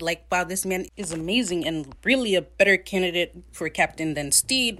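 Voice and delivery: ordinary talk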